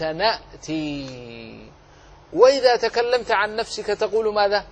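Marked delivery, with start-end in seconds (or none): 0.0-1.7 يأتي drawn out slowly, syllable by syllable; slow model word. Shown as a demonstration. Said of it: "وإذا تكلمت عن جماعة قلت".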